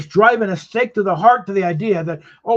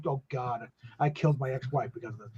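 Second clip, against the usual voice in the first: guilt-stricken; 6 semitones lower, 10 dB quieter than usual. Retold or imitated. Guilt-stricken man quoted.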